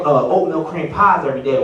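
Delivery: ordinary talk, nothing marked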